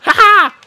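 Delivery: high loud gleeful whoop; cheeky victory cheer; triumphant ha-ha. Real, not acted. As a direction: crow with delight. Triumphant exclamation.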